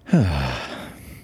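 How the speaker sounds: deep cathartic sigh, falling; long heavy sigh. Real, not acted. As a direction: let out one long, tired breath. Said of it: "That was almost cathartic".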